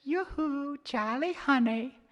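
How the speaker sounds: high tinkly flirtatious lilt; pretty mermaid voice. Acted. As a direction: coo to lure him. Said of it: "that same sweet voice".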